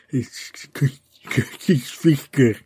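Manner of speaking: Costello Sofosco stumbled through in an English accent; clumsy English-accented Costello Sofosco. Shown as a demonstration. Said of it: "with an English accent".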